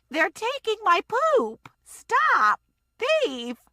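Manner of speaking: high, shrieking yell, slow on the first part. Acted. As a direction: scream at the thieves. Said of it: "the woman yelled".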